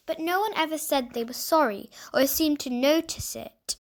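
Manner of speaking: ordinary delivery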